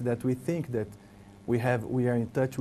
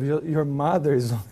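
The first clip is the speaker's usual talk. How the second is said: laughing; 2 semitones higher than usual. Retold or imitated. Laughing while retelling.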